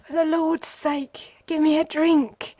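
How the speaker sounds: high, slow, desperate begging; whimpering plea. A narrator's reading. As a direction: plead for a drink as if it's your last hope. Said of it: "he whimpered".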